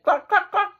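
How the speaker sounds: high clucking hen. Acted. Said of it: "And the hen said".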